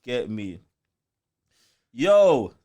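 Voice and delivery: ordinary talk, nothing marked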